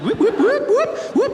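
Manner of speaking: high, loud rising siren whoops; police siren whoop. Retold or imitated. Police car signals him to stop, mimicked siren. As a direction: imitate a police siren in short rising bursts.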